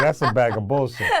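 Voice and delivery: blunt put-down said through a laugh; laughing mock verdict. Coach jokes about a harsh verdict on the answer, laughing. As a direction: laugh as you trash the answer. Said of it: "I could've been like".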